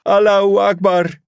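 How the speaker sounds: full-voiced cry to a silent crowd; shout. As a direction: yell it as hard as you can. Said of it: "En schreeuwde".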